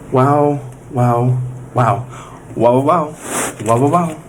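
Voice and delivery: low savouring wows; low repeated wows. Real, not acted. Tasting the pizza, low savouring wows. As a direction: groan out wow over and over.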